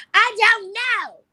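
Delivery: very high, loud, long-drawn whine; full bratty wail. Retold or imitated. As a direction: stretch I DON'T KNOW into a squealing tantrum. Retells escalated bratty answer to friends.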